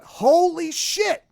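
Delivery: drawn-out amazed exclamation; stunned. Retold or imitated. Stunned by the happy ending.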